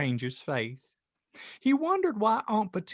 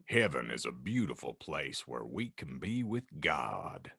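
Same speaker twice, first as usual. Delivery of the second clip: much lower, soft giant voice; gentle Hagrid; 14 semitones lower, 8 dB quieter than usual. Acted. Hagrid telling Harry about heaven, low soft voice. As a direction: speak low and tender to a child.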